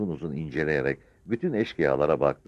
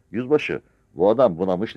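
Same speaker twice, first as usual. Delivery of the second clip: usual speaking voice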